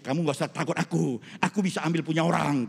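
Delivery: ordinary delivery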